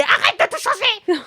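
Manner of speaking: high-pitched imitation of protesting listeners; mock outcry. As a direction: imitate an outraged crowd in a high voice. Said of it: "de gens qui me disaient".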